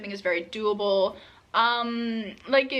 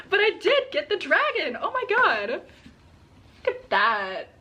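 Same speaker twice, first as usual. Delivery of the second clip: high, bright burst of real delight; thrilled squeal; 6 semitones higher, slower than usual. Real, not acted. Vlogger shows viewers the dragon edition she hoped for: real high excitement. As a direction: squeal over the dragon cover.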